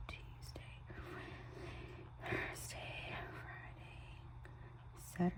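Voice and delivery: barely voiced, very soft; whisper. Real, not acted. Planner naming each day as she writes, whispering. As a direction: whisper along as you write. Saying weekdays while writing them.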